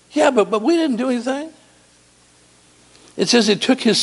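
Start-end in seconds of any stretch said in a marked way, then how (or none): 0.1-1.5 high whiny protest; protesting daughters. Retold or imitated. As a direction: squeak out the denial. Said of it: "His daughter's like".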